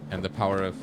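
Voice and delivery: usual speaking voice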